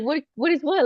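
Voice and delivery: high-pitched innocent confusion; playing dumb; feigned ignorance. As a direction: act clueless to dodge mom's question. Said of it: "like if I didn't know what she was talking about".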